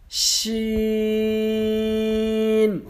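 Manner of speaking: شین held two seconds with pressure; pressed, long شین; pressed long Sheen. Shown as a demonstration. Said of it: "دبا کر پڑھنا ہے اور دو سیکنڈ لمبا".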